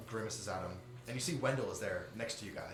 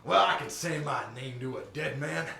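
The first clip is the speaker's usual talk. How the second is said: menacing gangster; 5 semitones higher, 10 dB louder than usual. Acted. NPC menacing the party.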